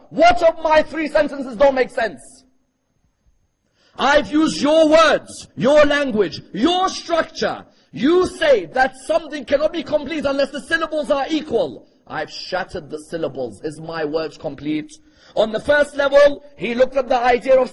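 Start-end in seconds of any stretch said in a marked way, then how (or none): 0.1-11.9 high, loud, ringing challenge; forceful challenge. Acted. Preacher voices Allah challenging the poets' rules, forceful challenge. 12.0-14.9 quiet challenge. Acted. Challenge drops to quiet question.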